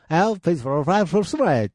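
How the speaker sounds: gibberish English train announcement; put-on English accent, run together; mock English announcement. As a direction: imitate an English announcer with nonsense words. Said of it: "もう本当に英語なんでしょ".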